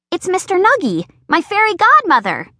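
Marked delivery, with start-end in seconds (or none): none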